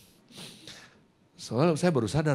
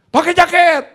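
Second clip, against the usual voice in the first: loud, very high barked command; snappish father; 14 dB louder than usual. Retold or imitated. Father orders son into a jacket, snappish high shout. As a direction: imitate a bossy dad shouting. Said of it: "Dia kalau ngomong nyolot begitu".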